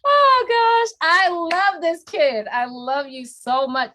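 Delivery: high, loud delight; high gushing joy. Real, not acted. Delighted by the kid.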